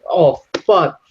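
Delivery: startled realization. Real, not acted. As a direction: catch yourself in dismay. Realizing slang slipped out.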